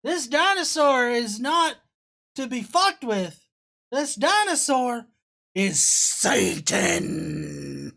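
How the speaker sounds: hammed-up sermon voice for the dinosaur reveal; mock preacher. Mocking the preacher's dinosaur-is-Satan claim, dramatic impression. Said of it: "It's like".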